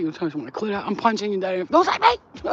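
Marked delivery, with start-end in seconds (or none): 1.6-2.5 shrieking refusal. Retold or imitated. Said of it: "post-orgasm woman voiced".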